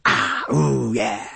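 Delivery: a low, relished sigh after drinking; satisfied sigh of enjoyment. Shown as a demonstration. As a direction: let the pleasure out in a sigh. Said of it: "But if I go".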